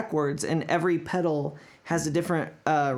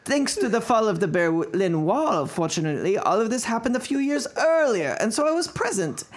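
put-on German accent waning toward the end; fading German accent. Finishing the quote, accent fading toward plain. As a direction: let the German slip as it goes. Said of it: "That was a terrible accent".

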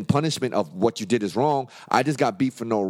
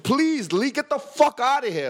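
desperate plea; 13 semitones higher than usual. Retold or imitated. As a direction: beg him to leave, close to a yell. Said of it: "I used to, like".

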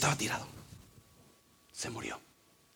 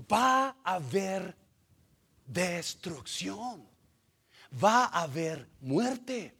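grave warning; 4 semitones higher, much slower than usual. Acted. Preacher warns of consequences.

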